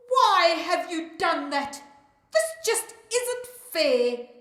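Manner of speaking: loud, high protest; crying out. A narrator's reading. Naledi protests to the fairy, crying out loudly. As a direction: cry out at the unfairness. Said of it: "Naledi cries out".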